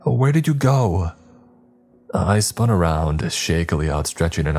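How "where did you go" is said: much higher, anxious wife's cry; worried wife. Acted. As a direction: cry out searching for him. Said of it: "Kara cried".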